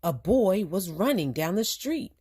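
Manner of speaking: usual speaking voice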